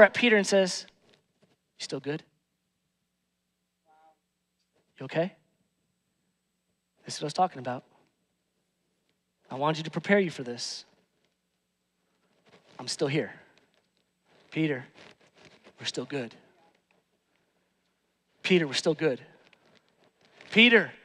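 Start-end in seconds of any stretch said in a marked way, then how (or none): 1.8-16.3 very soft, hushed and gentle; tender whisper. Acted. Jesus speaking tenderly to Peter. 18.4-19.2 gentle reassurance. Acted. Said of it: "That's what the look was".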